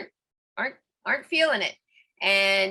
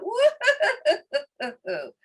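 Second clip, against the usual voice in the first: high woo tumbling into laughter; releasing laugh; 9 semitones higher, much slower than usual. Shown as a demonstration. Showing how to let grumpiness out, whooping laugh. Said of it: "we just let it out".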